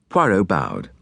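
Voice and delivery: usual speaking voice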